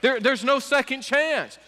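loud, high fiery preaching. Acted. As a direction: preach it out with full force. Preaching at full force.

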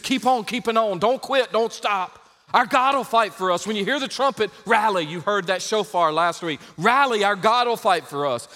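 loud, high rallying cry; rally the troops from the pulpit; preaching at full force. Acted. Preacher rousing his congregation, loud rallying cry.

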